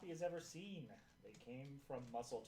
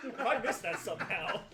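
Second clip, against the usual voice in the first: loud laughter through the words; laughing hard while talking; 5 semitones higher, 12 dB louder than usual. Real, not acted. Host laughing at own mistake.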